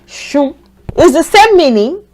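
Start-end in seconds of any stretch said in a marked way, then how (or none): 0.1-0.6 Shun said as "shoon", softer; SH sound. Shown as a demonstration. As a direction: say Shun with a clear sh. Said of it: "let's use that".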